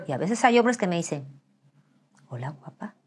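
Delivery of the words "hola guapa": soft, low come-on; quiet mock flirtation; lightly voiced flirting man. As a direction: murmur it like a suitor.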